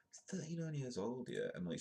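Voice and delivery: ordinary delivery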